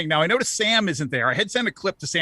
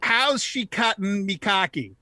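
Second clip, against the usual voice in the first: slow, higher-pitched "How's she cutting me cocky"; slowed-down Newfoundland greeting; 4 semitones higher, slower than usual. Shown as a demonstration. Guest coaching host through the greeting, slowed-down Newfoundland accent. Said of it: "Just slow it down a bit".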